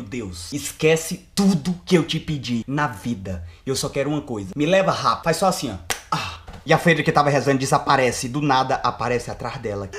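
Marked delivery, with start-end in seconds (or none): 0.0-5.2 desperate prayer. Pretend. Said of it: "eu olho pra cima e digo".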